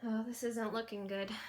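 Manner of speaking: plain reading voice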